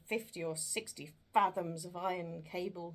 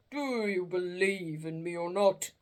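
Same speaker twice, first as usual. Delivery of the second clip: ghost voice; 8 dB louder than usual. Acted. Ghost speaks to Scrooge.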